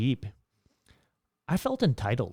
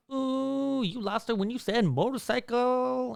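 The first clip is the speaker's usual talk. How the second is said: much higher, put-on snobbish voice; snooty bar guy; 12 semitones higher, slower than usual. Bougie guy at the bar mocking the motorcycle talk, high snooty voice. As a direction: sneer it down your nose like a rich snob.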